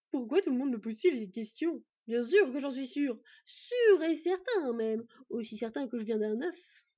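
loud, squawky bird, drawing out the insistence; indignant crow. Acted. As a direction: protest as an offended crow who is doubted.

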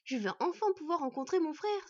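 put-on delighted little boy at the news; rejoicing boy. A narrator's reading. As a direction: burst out with happy anticipation. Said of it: "se réjouit Bord".

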